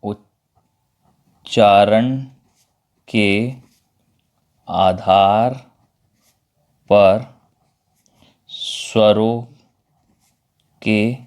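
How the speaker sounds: each word spaced out, long pauses between; slow dictation pace. Shown as a demonstration. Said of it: "slow करके देख लीजिएगा".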